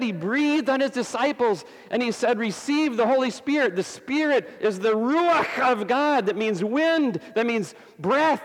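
high-pitched, driving sermon delivery; impassioned preaching. Real, not acted.

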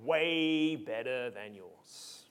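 low, slow, soft smackdown; boastful Paul. Acted. Paul showing off his resume to rivals, boastful. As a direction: draw it out, quietly one-upping the rivals. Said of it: "Paul is saying".

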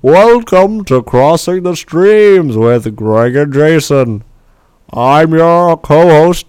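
slow, loud jolly Santa; booming holiday host as Santa. Acted. Co-host playing Santa intro.

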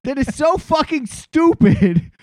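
high, laughing disbelief through the words; laughing rant. Real, not acted. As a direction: laugh it out in disbelief at your own city. Laughing rant about Boston fans.

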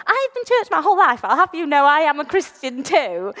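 high, huffy indignant protest. Retold or imitated. Wanting to answer the priest back, mock indignant. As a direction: bristle at being corrected. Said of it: "I wanted to go".

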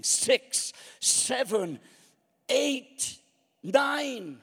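high, loud counting out; shouted referee count. Acted. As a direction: count her out ringside. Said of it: "The referee keeps on counting".

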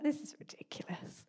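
whispered disbelief. Retold or imitated. Retold reaction to voice.

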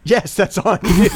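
slow, loud, laughing agreement. Real, not acted. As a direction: tease through laughter. Laughing teasing reply.

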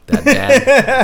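high, slow, laughing dodge. Real, not acted. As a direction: laugh while dodging it. Laughs, embarrassed about band name.

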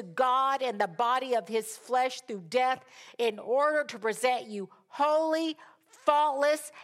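high, forceful proclamation; preaching scripture. Acted. Preacher reading her favorite verse to the congregation, emphatic proclamation. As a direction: declaim each quality as its own punch.

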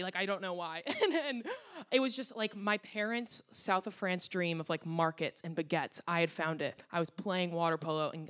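normal talking voice

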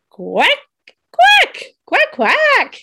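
high squeaky duck quacks. Pretend. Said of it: "Okay, the sound of a duck".

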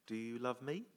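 ordinary talk

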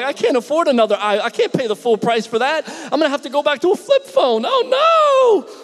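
a hammed-up dread that builds into a shrill oh no; mock panic. Pastor panicking at having to go back to a flip phone, hammed-up wail. Panicking about losing his iPhone.

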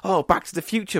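high-pitched delighted movie fan; mock excitement. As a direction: imitate someone thrilled by a new film. Excited retold cinema reactions.

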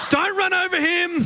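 shouted warning. Retold or imitated. Late arrival, friend taps his watch, [name] shouts to himself.

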